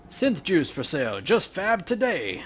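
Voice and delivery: hammed-up street hawker; market vendor call. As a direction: lure buyers from your stall.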